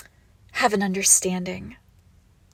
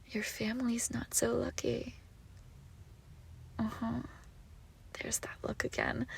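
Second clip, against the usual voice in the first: soft, gloating whisper; sinister whisper; 2 semitones higher, 12 dB quieter than usual. Acted. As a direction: hush the threat and savour it. Sinister reveal.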